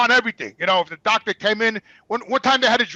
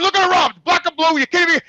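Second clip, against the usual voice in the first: higher, incredulous angry protest; indignant outrage; 7 semitones higher, 4 dB louder than usual. Retold or imitated. Son showing nurses the bruised arm, indignant. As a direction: argue in heated disbelief.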